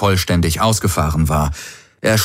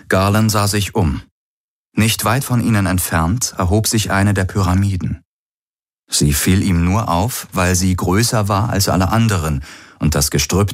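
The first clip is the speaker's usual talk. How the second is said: plain reading voice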